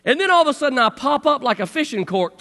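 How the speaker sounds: ordinary talk, nothing marked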